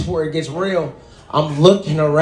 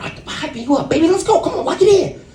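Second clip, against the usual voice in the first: hype-man pep; 11 semitones higher, faster than usual. Retold or imitated. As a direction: pump him up like a hype man. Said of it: "my boy was like".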